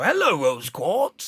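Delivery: put-on slow greeting in a thick Wolverhampton accent. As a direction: imitate a mate's Wolverhampton accent. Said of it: "a thick Wolverhampton accent".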